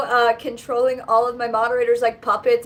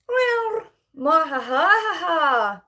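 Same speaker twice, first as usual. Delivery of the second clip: very slow mwahaha, evil laugh; mad scientist cackle; 4 semitones higher, much slower than usual. Pretend.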